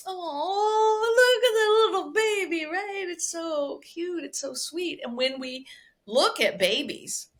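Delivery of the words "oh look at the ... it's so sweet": put-on high, gushing baby-admiring coo; cooing over baby. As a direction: melt over a tiny baby, stretched and lifted. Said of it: "be like".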